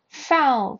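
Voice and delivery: loud, high one-word call; umpire's shout. A narrator's reading. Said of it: "the umpire shouted".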